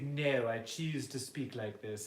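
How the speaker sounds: slow fake British accent; slow, put-on aristocratic British reply; Mister Dillon. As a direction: imitate an affected British teacher, drawn out. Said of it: "like, a fake British accent".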